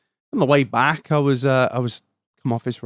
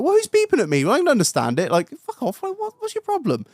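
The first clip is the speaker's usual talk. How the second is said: mock confused tractor driver; 12 semitones higher than usual. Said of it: "he's looking around like".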